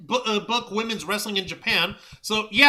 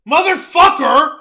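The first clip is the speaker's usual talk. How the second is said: loud, higher shout held slow; furious shout; 4 semitones higher, 10 dB louder than usual. Real, not acted. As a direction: bellow it in outrage. Angry outburst at Paramount idea.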